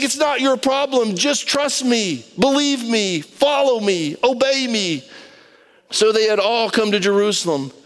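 ordinary delivery

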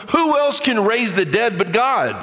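ordinary talk

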